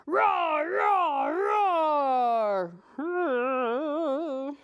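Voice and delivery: put-on loud, playful dinosaur roar for kids. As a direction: roar like a big cartoon dinosaur. Dinosaurs defeat the kitties' plan.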